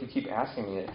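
ordinary talk, nothing marked

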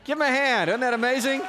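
high, loud, excited shout; rally the crowd to clap; calling for applause. Real, not acted. Calls for applause for friend.

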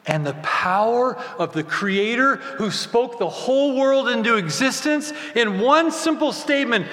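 ordinary delivery

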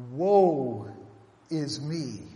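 loud cry of woe; anguished cry. Retold or imitated. Isaiah before God's holiness, loud cry of woe. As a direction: cry out in dread.

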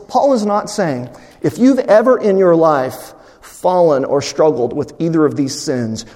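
usual speaking voice

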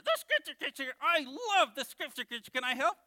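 high, loud, thrilled puppet; Bones. Pretend. Puppet begging to help with the lesson, high excited voice. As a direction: squeal with eagerness as a puppet. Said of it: "I'm your ventriloquist".

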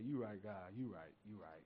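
humbled murmured yes; sheepish answer. Retold or imitated. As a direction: concede quietly to God, dropping away. Pastor humbly answering God.

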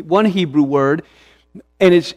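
normal talking voice

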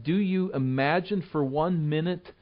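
normal talking voice

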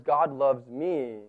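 low, slow, reluctant admission; subdued and unsure, words coming slowly; hesitant. Shown as a demonstration. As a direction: say it as if it is hard to believe. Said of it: "doesn't come off the lips quite as easily".

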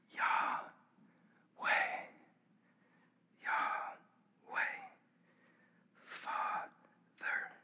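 whispered names on each breath; breath prayer. Shown as a demonstration. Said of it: "just to be like".

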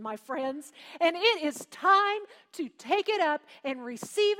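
building revival shout; fiery preaching. Acted. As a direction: call the church to say amen. Preaching climax calling for amens.

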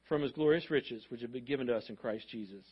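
ordinary delivery

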